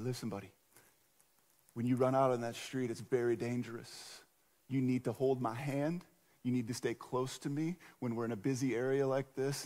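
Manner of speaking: put-on soft, low and patient, like a dad kneeling to his kid; quietly pleading with a child to stay close; gentle fatherly warning. Dad kneeling down to his little boy, soft and patient.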